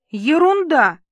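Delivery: high, loud scoffing retort; dismissive outburst. Acted. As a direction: chop the word off with scorn. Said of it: "рубанул рукой воздух Иван".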